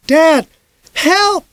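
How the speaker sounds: high panicked cry for help; boy screaming for dad; desperate shout. A narrator's reading. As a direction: scream for your father. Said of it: "I shouted".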